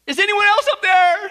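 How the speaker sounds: high, loud shout upward; panicked yell. Acted. Tourist rejects the advice and calls heaven, desperate yell. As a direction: yell out for any other rescuer. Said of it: "and the tourist yelled".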